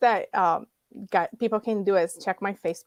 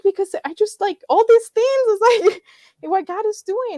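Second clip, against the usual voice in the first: higher and louder, choked up with a laugh; tearful retelling; 12 semitones higher, 7 dB louder than usual. Retold or imitated. To her husband, explaining why she cried, tearful and high. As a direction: relive the overwhelmed tears, half laughing. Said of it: "I was crying on my way there".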